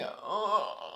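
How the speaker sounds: long real groan at a sting; pained groan. Real, not acted. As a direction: groan through stinging eyes. Reacting to stinging eye drops.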